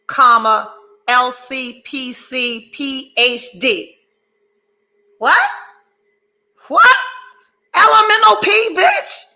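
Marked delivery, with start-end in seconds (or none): 5.2-9.2 much higher and louder, rising "What?"; hyped boast. Real, not acted. Showing viewers her degree titles, hyped triumphant shout. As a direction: brag like you can't believe it.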